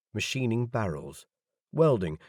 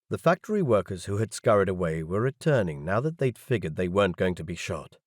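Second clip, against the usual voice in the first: usual speaking voice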